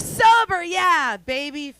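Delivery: mocking crowd. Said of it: "They're like".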